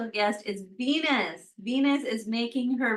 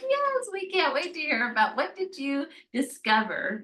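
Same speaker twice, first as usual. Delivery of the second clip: playful, higher-pitched chorus of keen listeners; eager mock reply; 5 semitones higher than usual. Listeners answer Venus back from the underworld, playfully eager. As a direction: act out an eager crowd, pitch lifted.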